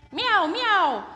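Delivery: high loud meows; cat meowing. Acted. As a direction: meow like a cat. Cat noise.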